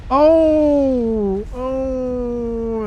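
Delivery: loud, high, drawn-out "ohh" that then softens; long wincing cry at a big hit; shocked "oh". Real, not acted. Caster watching the nuke land, shocked long "oh".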